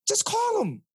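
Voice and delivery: just tell them to do it; emphatic urging. Real, not acted. Urging listeners to call.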